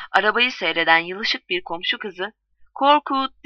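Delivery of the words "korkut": higher, louder echo of a call; mimicking call. A narrator's reading. Neighbour girl in the street copying Filiz, mimicking call. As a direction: parrot the caller's cry. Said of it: "diye yansıladı".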